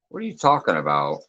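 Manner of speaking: ordinary talk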